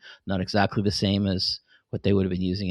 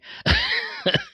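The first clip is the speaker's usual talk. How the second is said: squeaky laugh. Real, not acted. Laughing at own idea.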